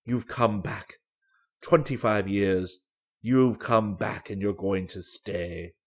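low, slow, husky man's voice; huskily moved old suitor. A narrator's reading. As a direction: let it sink low and slow with emotion. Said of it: "said old Danby huskily".

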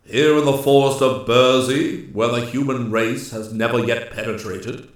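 loud, incredulous forest ruler; questioning Master Woodsman. A narrator's reading. Master Woodsman questions the nymph's wish to keep a human, challenging. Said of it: "questioned Ak".

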